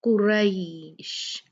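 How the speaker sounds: कुरैश said slowly with a clear ai sound, kur-aish; slow Quranic kuraish; careful recitation. Shown as a demonstration. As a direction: draw out कुरैश as kur-aish, not kur-esh. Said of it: "ये रे नहीं है".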